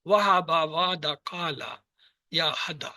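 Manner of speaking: drawn-out slow examples; slow teacher listing. Shown as a demonstration. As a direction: spell each word out slowly. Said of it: "اور یہ جو کمزور ہیں".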